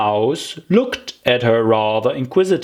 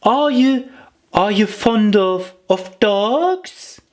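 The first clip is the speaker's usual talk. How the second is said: put-on higher, halting girlish voice; nervous question; 10 semitones higher, slower than usual. Alice changing the subject, higher and halting.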